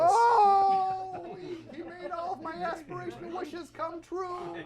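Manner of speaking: hammed-up cry of grief, then overwrought wailing; theatrical outburst of mock despair; puppet's mock anguish. Puppet to host, wailing over the dead celebrity.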